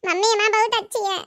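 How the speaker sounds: put-on high little girl's voice, a bit fast on the end; child voice. Small daughter telling mother and father apart, high child voice. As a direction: play the young daughter talking to her parents.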